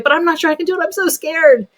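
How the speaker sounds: a frightened little figure; scared toy voice. Pretend. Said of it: "bringing emotions to life".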